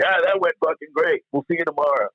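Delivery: high-pitched, approving cheer in retelling; imitated George Carlin. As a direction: mimic an applauding comic's hearty praise. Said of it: "like, applauding me".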